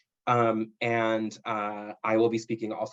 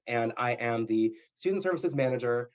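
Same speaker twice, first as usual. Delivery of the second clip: normal talking voice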